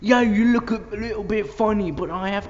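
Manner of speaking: high, loud mock Cockney character. Gamer voicing a party guest, loud Cockney. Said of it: "Don't know why I made him cockney".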